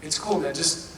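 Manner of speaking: soft, calming hey hey hey; quiet easy-going reassurance; reassuring. Retold or imitated. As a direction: settle an upset co-star down gently. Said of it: "he could tell, and he kinda goes".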